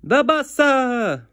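high slow loud grandma call; grandma yelling. Acted. Grandma calling the boy down again. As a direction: stretch it out like grandma hollering. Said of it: "she yells again".

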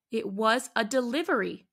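plain reading voice

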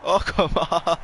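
loud, high protest; exasperated protest. Real, not acted. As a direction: cry out in exasperation at the play. Protests at a game play.

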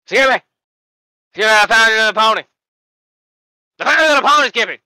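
high gleeful yell over a pony; drugged patient shouting with joy; giddy drugged shout. Acted. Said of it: "effects of the anesthesia".

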